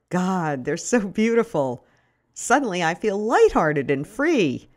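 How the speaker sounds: plain reading voice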